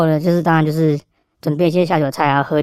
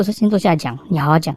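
ordinary talk

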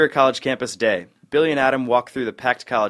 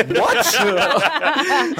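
high, laughing "What?"; shocked and laughing at once; laughing disbelief; 12 semitones higher than usual. Real, not acted. Shocked at the deal.

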